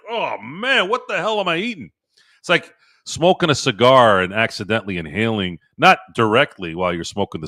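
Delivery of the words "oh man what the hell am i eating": high, mock-bewildered voice; imitating dazed dad. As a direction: play the dizzy first-timer, much higher. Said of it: "And he just like".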